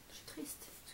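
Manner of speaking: whispering. Real, not acted. Sad that candles are missing.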